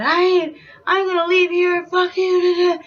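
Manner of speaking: high mock yell, trailing into filler; retold yelling. Yelling while getting up, livid, retold yell. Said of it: "and I'm just yelling".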